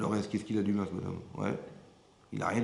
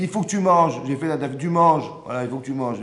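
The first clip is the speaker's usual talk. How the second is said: loud, high mock insistence from the wife; insistent wife; 4 semitones higher, 11 dB louder than usual. Wife insisting her husband eat, loud and high.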